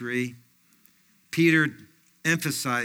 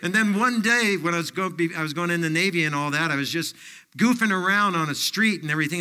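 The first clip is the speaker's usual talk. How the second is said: ordinary talk, nothing marked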